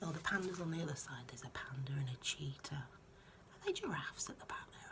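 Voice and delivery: hushed whispered aside. Real, not acted. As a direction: whisper about the picture details. Whispered commentary on pictures.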